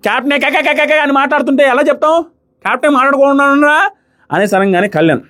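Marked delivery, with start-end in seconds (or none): 0.1-3.8 overdone mockery. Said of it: "చాలా over చేశారు".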